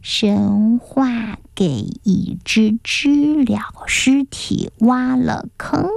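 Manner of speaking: slow, syllable by syllable under breath; slow quiet practice. A narrator's reading. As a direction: practise it slowly and carefully. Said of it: "悄悄在座位上学着花一朵说话时的样子".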